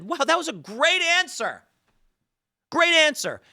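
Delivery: high, bright praise of the answer; praising the chatbot's answer in a high, delighted voice. Retold or imitated. Praising Grok's good answer.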